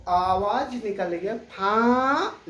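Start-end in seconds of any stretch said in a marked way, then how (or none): 1.4-2.5 फां with the आं ringing through mouth and nose; फां said loudly with an open nasal vowel; nasal vowel demo. Shown as a demonstration. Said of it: "देखिए मुंह कैसे खुला हुआ है".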